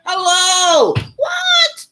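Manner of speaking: high, shouted disbelief; outraged disbelief. Acted. Shouting in disbelief at neighbors who heard nothing. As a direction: cry out in shock at the neighbors.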